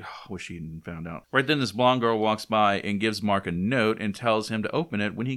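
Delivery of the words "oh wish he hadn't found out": mumbled complaint. Retold or imitated. Mark's quiet complaint.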